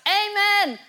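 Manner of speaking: high, full-voiced congregation shout; shouted amen. Preacher acting out someone answering a testimony, loud shout. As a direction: belt it out like an excited churchgoer. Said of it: "and somebody shouts".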